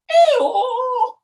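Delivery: high, loud hee-haw; loud two-note donkey call; donkey bray. Acted. Acting the old donkey's bray.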